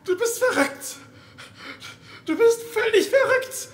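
yelling at the woman who trapped him; terrified shout. Acted. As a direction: scream it at her in horror. Confronting the killer.

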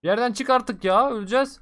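frantic loud gamer panic; tense shout. Real, not acted. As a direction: yell it as if about to die. Tense firefight in game.

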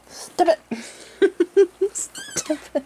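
high squeals of stop between giggles; ticklish laughing protest. Real, not acted. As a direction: protest helplessly while being tickled. Being tickled, protesting while laughing.

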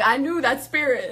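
ordinary talk

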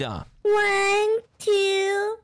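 drawn-out hide-and-seek count; long, spaced-out counting call. Real, not acted. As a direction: count aloud for a hider to hear. Seeker calls out the count.

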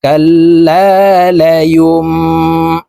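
কাল্লা লায়ুম drawn out with nasal ghunnah; ghunnah on the mim. Shown as a demonstration. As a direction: hold the nasal sound in কাল্লা লায়ুম slowly. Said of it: "গুণনার সহিত পড়ব". (গুন্নাহসহ ধীরে তিলাওয়াত)

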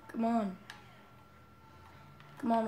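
ordinary talk

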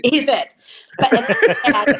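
loud, laughing punchline. Real, not acted. Mother joking about her son, laughing loudly. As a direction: belt it out through real laughter.

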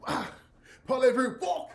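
high, loud fake French with cursing; bad French accent breaking character; mock French. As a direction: ham up a French actor in pain. Actor in fake French, hurt.

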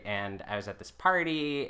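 normal talking voice

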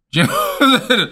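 laughing through a rhetorical question; amused laugh as he asks for agreement. Real, not acted. Advice giver laughing as he checks listeners follow. Laughs at his own point.

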